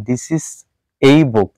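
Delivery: "a" said as the letter name "ay"; wrong-example "ay" for the article "a"; mispronounced article. Shown as a demonstration. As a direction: say "a" as a full "ay" before "book". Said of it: "এই বুক বলতে পারবো না".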